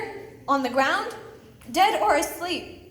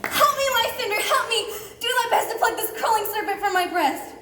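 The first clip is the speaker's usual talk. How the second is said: frightened cry for help; 7 semitones higher than usual. Acted. Hermia waking from a bad dream, crying out in fright.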